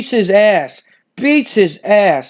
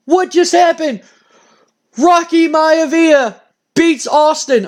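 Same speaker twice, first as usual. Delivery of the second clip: stunned disbelief; 6 semitones higher, slower than usual. Real, not acted. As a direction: let the words drag out, floored by the upset. Upset win in the game.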